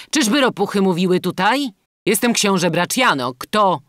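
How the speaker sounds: prince's loud, high challenge; calling out. A narrator's reading. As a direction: call out imperiously to an unseen stranger. Unseen prince calls to bandit.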